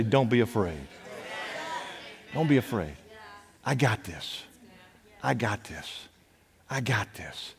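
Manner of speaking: very soft, low, intimate voice; hushed reassurance. Retold or imitated. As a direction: drop low and soft, as if comforting privately. Voices Jesus reassuring the fearful.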